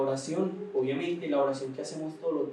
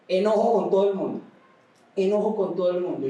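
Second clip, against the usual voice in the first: retold anger; 3 semitones higher, 6 dB louder than usual. Retold tearful confession.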